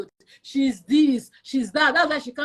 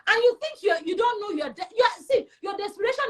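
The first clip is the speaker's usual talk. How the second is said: usual speaking voice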